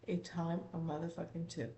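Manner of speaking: usual speaking voice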